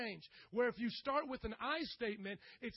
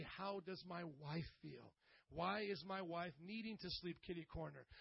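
quiet self-reflection; 4 semitones lower, 8 dB quieter than usual. Retold or imitated. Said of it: "I need to now say".

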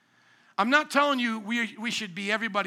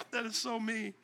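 amused, quieter aftermath of a joke; laughing; 9 dB quieter than usual. Real, not acted. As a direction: crack up at yourself, then speak softly through it. Laughing at his own bit.

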